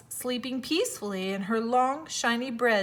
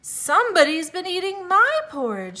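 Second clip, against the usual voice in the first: higher, louder mama bear; middle-sized bear voice; 8 semitones higher, 6 dB louder than usual. A narrator's reading. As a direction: lift the pitch for the mother bear. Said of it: "in her middle-sized voice".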